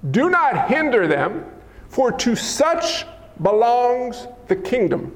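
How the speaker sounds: slow, weighted command; measured indignation, word by word; indignant Jesus. Acted. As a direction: draw each word out in stern rebuke. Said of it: "Indignant means angry".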